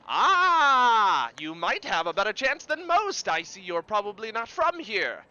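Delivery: showman's patter opening on a long, loud "Ah!"; carnival barker. Acted. Carny greeting an outsider stepping up, lively barker voice. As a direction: size up the newcomer, then rally them.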